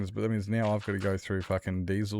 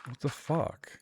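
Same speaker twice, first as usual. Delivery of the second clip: incredulous; 6 semitones higher, 3 dB quieter, slower than usual. Real, not acted. Incredulous reaction to remark.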